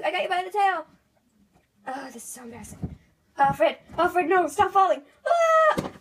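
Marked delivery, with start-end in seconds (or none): 3.4-5.9 loud, high yells of alarm; frantic shouting. Pretend. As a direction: yell desperately after him. Friend keeps falling.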